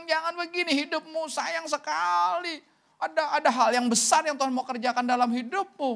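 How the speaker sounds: high-pitched retold exhortation; earnest pleading. Pastor urging the grieving man not to live so, high pleading.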